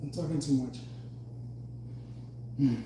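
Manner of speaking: ordinary delivery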